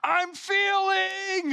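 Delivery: high, stretched wail of a Grinch; Grinch crying out. Retold or imitated. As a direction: squeal it out like the Grinch overwhelmed. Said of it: "He goes".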